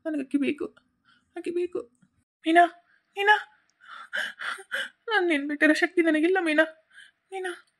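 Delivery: tearful, heartbroken pleading; crying. Acted. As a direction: cry out for a lost love. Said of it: "ಯಾಕೆ ಅಳಕತ್ತಿ".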